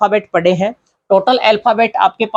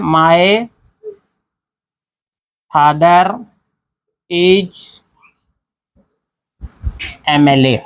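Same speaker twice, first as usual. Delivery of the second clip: one word at a time, with long pauses between them; teacher dictating an example sentence, word by word; dictating slowly; much slower than usual. Shown as a demonstration. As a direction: spell the sentence out slowly as if writing it on the board. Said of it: "मैं एक sentence लिख रहा हूँ".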